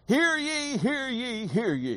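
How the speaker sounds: high ringing town crier call, then fading. Shown as a demonstration. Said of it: "It's kind of the way I like to hear it".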